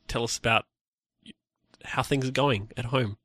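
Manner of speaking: usual speaking voice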